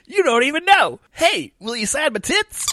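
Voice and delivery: put-on eager young man. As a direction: play the starry-eyed young host. Young self in vision.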